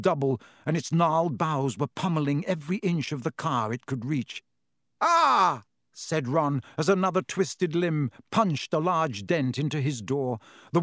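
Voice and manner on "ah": startled cry. A narrator's reading. Branch dents Ron's door.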